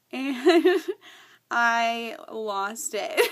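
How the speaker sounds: slow, loud words breaking into laughter; tearful laughter. Real, not acted. Woman telling how God's love overwhelmed her, laughing tearfully. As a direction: laugh through tears, drawing the words out. Overcome retelling God's answer.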